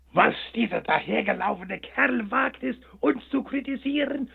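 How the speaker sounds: high, outraged shriek; furious at the upstart hare; sunflowers screaming. A narrator's reading. The sunflowers shriek at the hare in outrage. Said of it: "schrien sie zu ihm".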